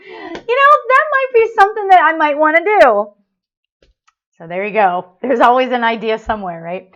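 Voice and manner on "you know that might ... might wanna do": high, loud, playful Mrs. Claus voice; Mrs. Claus musing aloud, pitched way up; playful Missus Claus. Pretend. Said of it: "I could do Saint Missus Claus very easily".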